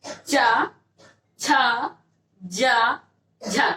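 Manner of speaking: चा, छा, जा, झा as long separate syllables; sound demonstration. Shown as a demonstration. Teacher asking students to practise, चा, छा, जा, झा sounded out slowly. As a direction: draw out चा, छा, जा, झा, pausing between them. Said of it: "बोलिएगा, जैसे".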